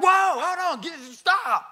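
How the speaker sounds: high, loud yelp of warning; sudden hammed-up shriek to stop the driver; imitated panicked yell. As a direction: mimic a wife yelling from the passenger seat. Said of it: "she's yelling at me".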